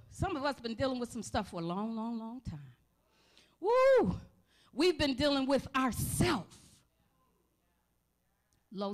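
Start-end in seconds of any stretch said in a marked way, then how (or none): 3.6-4.2 high, loud joyful whoop; excited woo. Real, not acted. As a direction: let out a spirited whoop. Preacher exclaiming mid-sermon.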